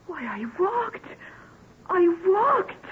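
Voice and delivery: stunned delight rising; amazed joy. Acted. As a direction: gasp it out as the wonder hits. Realises she has walked.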